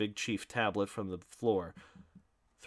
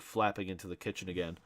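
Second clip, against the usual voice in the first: ordinary delivery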